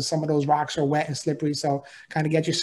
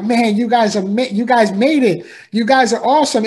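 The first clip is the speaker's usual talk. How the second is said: loud, higher cheering for the finishers; cheering crowd; 5 semitones higher, 5 dB louder than usual. Retold or imitated. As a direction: shout it up like a crowd cheering people on. People clapping and cheering us on.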